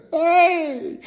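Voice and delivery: high burst of laughter, Hey!; laughing whoop. Real, not acted. Preacher laughs at the news live on air, high laughing yelp. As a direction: let a big laugh break out. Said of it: "I shouldn't be laughing".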